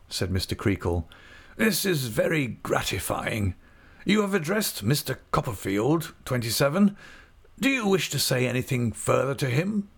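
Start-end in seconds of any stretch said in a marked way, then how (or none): 1.6-9.9 pitched-up and self-important; a pompous magistrate showing off his prisoner; high, fussy official. A narrator's reading. Creakle asking Uriah what he wants in a high pompous voice.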